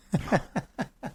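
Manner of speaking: high, soft burst of chuckles; a real, quick run of giggles; laughing. Real, not acted. Interviewer reacting to climber's grim understatement, laughing. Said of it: "Sorry, I'm laughing".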